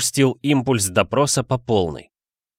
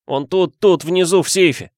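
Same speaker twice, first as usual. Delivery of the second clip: blurted out; 7 semitones higher, 3 dB louder than usual. Acted. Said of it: "быстро выпалил".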